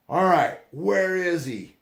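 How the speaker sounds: high, loud, drawn-out shout of an angry dad; angry yell. Retold or imitated. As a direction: bellow it, stretching out each word. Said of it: "And he just yells".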